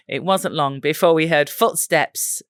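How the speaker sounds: ordinary delivery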